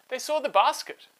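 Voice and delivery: usual speaking voice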